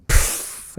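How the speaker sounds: imitated burst of spouting breath; whale blowhole puff. Storyteller imitates whales surfacing through ice, explosive puff. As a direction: blow out a sharp airy puff like a whale. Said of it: "whales breaking through the ice, going".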